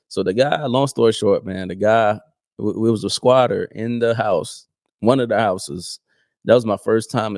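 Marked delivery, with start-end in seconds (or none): none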